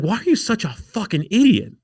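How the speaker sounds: mock the dad's outrage at him; exasperated father.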